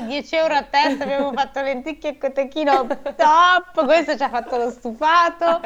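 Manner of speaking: high-pitched mock reveller voice; hammed-up festive farewell; mock party-goer. Acts out party-goers saying goodbye.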